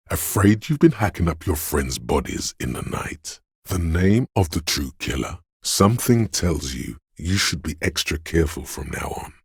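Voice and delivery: ordinary talk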